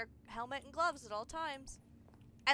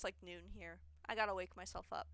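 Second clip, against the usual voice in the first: usual speaking voice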